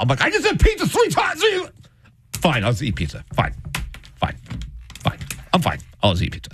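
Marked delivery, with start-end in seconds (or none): 0.2-1.4 very high, squealing protest; mock exasperated yell. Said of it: "it's a fight". 2.3-6.5 short, resigned replies with pauses; dropped-down, muted huff; mock sulky surrender. Woman gives in, sulking.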